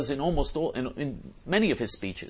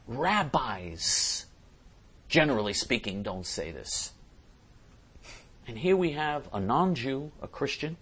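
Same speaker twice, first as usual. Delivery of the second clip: usual speaking voice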